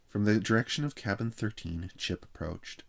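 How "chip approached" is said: plain reading voice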